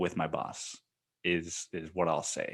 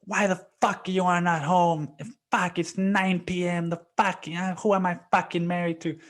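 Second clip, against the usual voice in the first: imitating angry wife; 10 semitones higher than usual. Wife yelling on phone.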